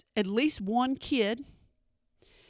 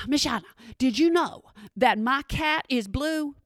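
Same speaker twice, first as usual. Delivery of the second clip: put-on excited kid; 2 semitones higher than usual. Excited kid telling the teacher about a blue cat. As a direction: blurt out a silly fact like an eager kid. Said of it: "And they're like".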